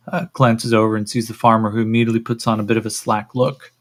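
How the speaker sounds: usual speaking voice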